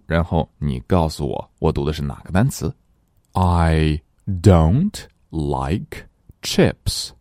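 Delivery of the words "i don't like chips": very slow, careful reading for a listening test; slow dictation reading. Shown as a demonstration. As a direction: read it slowly so pupils can pick out "chips". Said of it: "我来读，然后你告诉我". (极慢的听力示范朗读)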